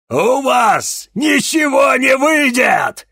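slow, very high roaring taunt; malicious shout. A narrator's reading. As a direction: gloat it with malice. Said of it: "злобно расхохотался демон".

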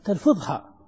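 ordinary talk, nothing marked

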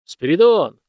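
loud barked name; a master's angry bark through the door; barking shout. A narrator's reading. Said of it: "рявкнул Иван Фёдорович".

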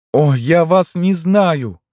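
very high, drawn-out, slow wail; anguished cry. A narrator's reading. Tormented woman repeats her denial, anguished cry. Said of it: "крикнула она".

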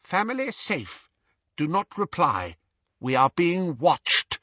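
a lightly voiced urgent father's warning; voice of Ron's father. A narrator's reading. Mr Weasley's Patronus warning the family, distant and terse. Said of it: "spoke with the voice of Ron's father".

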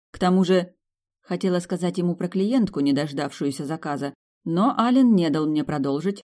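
plain reading voice

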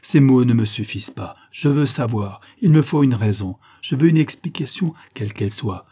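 loud bursts of wounded insistence; indignant protest. Acted. Rejected lover insists on an explanation, indignant and hurt. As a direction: demand it forcefully, voice leaping in protest.

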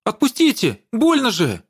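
high, pained cry; painful shriek. A narrator's reading. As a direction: shriek as your ear is twisted. Grandmother grabs her ear.

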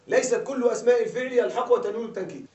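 usual speaking voice

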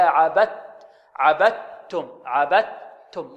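عبدتم as "abattum", no trace of d; slow عبدتم with د fully merged into ت; correct pure t. Shown as a demonstration. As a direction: hold a clean t in the middle of عبدتم. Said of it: "هكذا".

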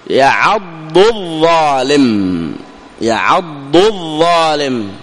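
very slow يعض الظالم, ض and ظ kept apart; careful recitation. Shown as a demonstration. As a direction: draw out each letter of يعض الظالم. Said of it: "الذي مثل به الناظم".

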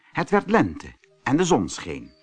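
ordinary delivery

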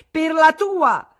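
very high, loud, slow shout. Acted. Wife rising to accuse her husband, shouting. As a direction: hurl it at him. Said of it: "gridò Madame Arnoux".

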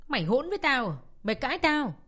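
mother yelling high and loud at her daughter; angry shout. Acted. Mother shouting at the daughter who talks back, furious yell. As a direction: scream it at the defiant child, furious.

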